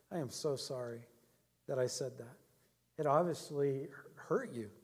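low, soft, retold apology; gentle apology. Pastor apologizing to an angry deacon, gently sincere.

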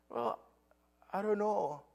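high-pitched imitation of a crying boy; retold tearful little voice; tearful child. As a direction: play a child faltering through tears. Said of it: "he started crying".

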